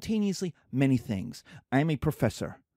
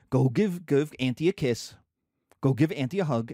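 low-pitched parental order; instructing parent; 6 semitones lower than usual. Acted. Parent sending child to greet auntie, low firm voice. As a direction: tell the child firmly, voice dropped. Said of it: "Even your parents are like".

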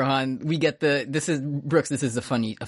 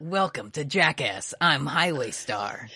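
Steve-O impression; slower than usual. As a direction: greet like a stunt show host. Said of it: "He sounds like Steve-O".

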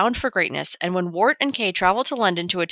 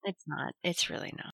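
soft, nearly whispered; quiet agreement; 4 semitones lower, 14 dB quieter than usual. Real, not acted. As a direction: mutter the agreement under the breath.

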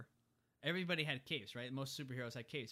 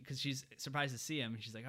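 normal talking voice

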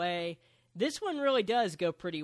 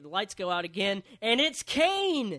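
mock big reveal; 2 semitones higher, 6 dB louder than usual. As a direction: hype the fake surprise entrance. Fake reveal told as joke.

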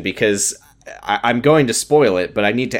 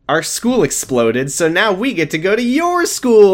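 high, gleeful imitated delivery; mock excited announcement; 7 semitones higher than usual. Recapper as Dylan telling Ben about the exploded school, mock excitement. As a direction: announce it like thrilling good news.

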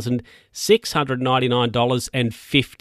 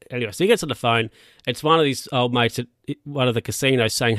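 ordinary delivery

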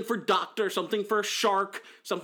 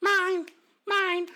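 possessive squeal; 13 semitones higher, slower than usual. Acted. Grabbing the pickle, playful.

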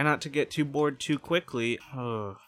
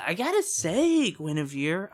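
excited; 7 semitones higher, 5 dB louder than usual. Real, not acted. Host excited after call.

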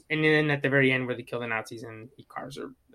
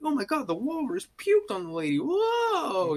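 high-pitched mock comedy-star voice; goofy imitation, swooping up high at the end; wacky, goofy; 12 semitones higher, slower than usual. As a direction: ham up the silly amazement. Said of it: "it's wacky, goofy".

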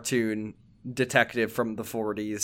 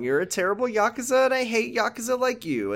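mocking Majima; 11 semitones higher than usual. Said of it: "And then Majima's like".

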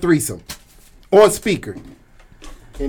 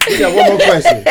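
much higher, laughing through it; 6 dB louder than usual. Real, not acted. Host repeating the announcement to the guests, laughing hard. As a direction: crack up as you repeat it.

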